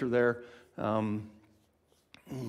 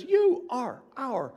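rejoicing declaration; 5 semitones higher, 4 dB louder than usual. Acted. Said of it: "rejoices and says".

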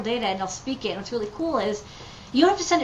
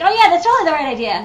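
excited agreement; 7 semitones higher, 10 dB louder, faster than usual. Retold or imitated. Team agreeing on idea.

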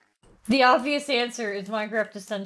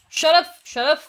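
loud, irritated shout; annoyed snap; 3 semitones higher, 7 dB louder than usual. Real, not acted. Snapping at phantoms in the game.

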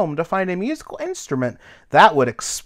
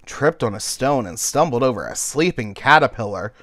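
ordinary delivery, nothing marked

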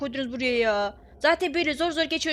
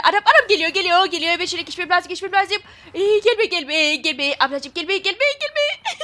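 louder, higher frantic begging; chased player pleading the monster not to come; panicked pleading; 6 semitones higher, 8 dB louder than usual. Real, not acted. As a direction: beg the monster to stay away, in panic.

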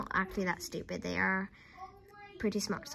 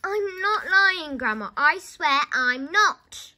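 loud, slow, insistent denial; shouted protest; 7 semitones higher, 10 dB louder, slower than usual. A narrator's reading. George denies lying to Grandma, protesting loudly. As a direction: swear it loudly, drawing it out.

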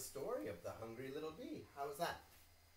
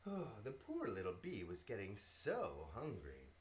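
usual speaking voice

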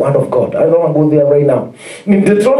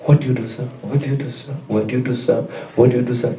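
quieter, repeated prying question; a touch of nosy shopkeeper; 5 semitones lower, 7 dB quieter, faster than usual. Retold or imitated. Preacher mimics a shopkeeper questioning him when paying cash, quietly. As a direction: softly mimic a curious seller. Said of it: "I gave a testimony".

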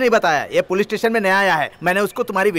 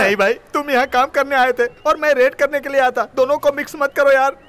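raised, higher exasperated pleading; exasperated protest; 9 semitones higher, 5 dB louder than usual. Acted. Constable protests to the man, loud and exasperated. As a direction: push back loudly, fed up.

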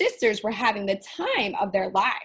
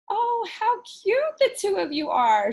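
put-on sweet old woman; 10 semitones higher, 5 dB louder than usual. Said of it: "she said to the tiny witch twins".

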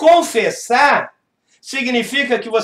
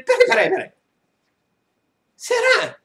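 doubtful high peraí; 13 semitones higher than usual. Real, not acted. Doubting the laughing convert.

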